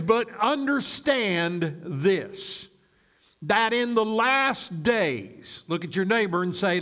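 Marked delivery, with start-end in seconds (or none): 0.0-5.6 slow, deliberate reading with drawn-out key words; slow scripture reading. Real, not acted. Pastor reading Second Timothy to the congregation, slow and deliberate. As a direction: read it slowly so it sinks in. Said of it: "we're going to read it slowly".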